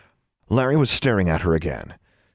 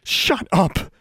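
higher-pitched angry retort; hissed; 10 semitones higher than usual. A narrator's reading. Trembling girl silences her tormentor, hissing. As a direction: shut them down through a tight hiss. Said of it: "she hissed".

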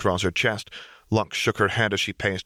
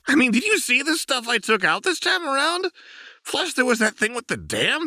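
much higher, gleeful manic prankster; manic Jinx; 12 semitones higher than usual. A narrator's reading. Jinx boasting to Lux about her destruction, gleeful and manic. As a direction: brag about the chaos like a giddy kid.

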